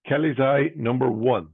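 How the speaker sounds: sing-song bingo number calling; bingo caller. Real, not acted. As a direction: lift the first half, drop the second.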